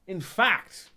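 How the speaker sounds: sudden loud start; too loud. Real, not acted. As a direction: blurt it out too loud by accident. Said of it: "oh, that was a bit loud, sorry".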